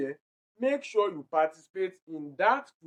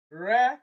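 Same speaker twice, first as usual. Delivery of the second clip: "Re" alone, raised and emphatic; stressed syllable; 4 semitones higher, 4 dB louder than usual. Shown as a demonstration. Teacher isolates the stressed part of refuse, emphatic. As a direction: punch "Re" by itself, higher. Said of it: "that re is stressed".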